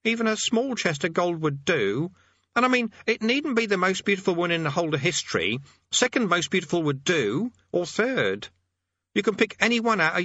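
high, wistful wheedling plea; voice twanged with longing. Acted. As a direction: plead hopefully in a high character voice. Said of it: "his voice twanged with longing".

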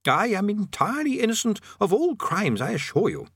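high start, settling on the assurance; prisoner protesting innocence. Acted. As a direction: protest politely and earnestly.

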